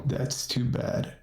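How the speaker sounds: slow, eerie unvoiced whisper; dark whisper behind you. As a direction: breathe it slowly from the shadows. Stranger woman replies from darkness.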